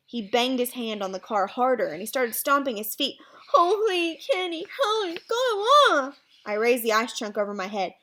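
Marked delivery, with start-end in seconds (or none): 3.5-6.2 slow, high garbled wail; muffled, drawn-out desperate cry; mouth stuck. Acted. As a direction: wail for help with lips stuck. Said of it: "sounding like he had something in his mouth".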